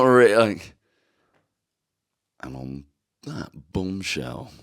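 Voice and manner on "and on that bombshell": slow, low, soft portentous sign-off; a hint of Clarkson, dragged out for drama; lightly voiced TV presenter; Jeremy Clarkson impression. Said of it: "Can you do a Jeremy Clarkson?".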